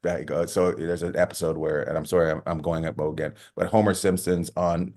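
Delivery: ordinary delivery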